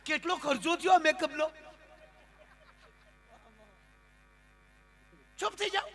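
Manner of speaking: high, loud scolding bride telling everyone to hush; bride shouting. Acted. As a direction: shriek at the crying family to stop, half laughing. Bride shouts at weeping family.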